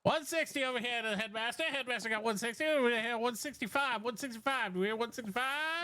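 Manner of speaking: fast auction patter calling bids; auctioneer. Acted. S0 runs scooter auction.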